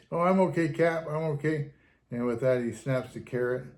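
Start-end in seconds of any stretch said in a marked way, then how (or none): 0.1-1.7 higher, louder, carefree reassurance; breezy brush-off. Retold or imitated. Acting driver answers the captain, breezy and higher. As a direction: wave off the captain's worry.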